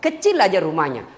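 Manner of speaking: usual speaking voice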